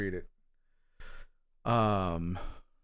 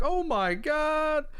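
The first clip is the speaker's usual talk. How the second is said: slow, much higher gasp of disbelief; retold amazement; much slower than usual. Streamer recalling a comic twist, slow high stunned disbelief. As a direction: stretch out a stunned, high oh my God. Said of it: "I was like".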